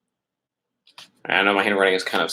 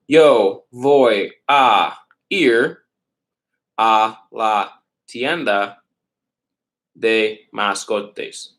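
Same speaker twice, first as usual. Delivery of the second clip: slow dictation; much slower than usual. Shown as a demonstration. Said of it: "teacher writes and dictates slowly".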